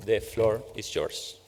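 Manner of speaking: usual speaking voice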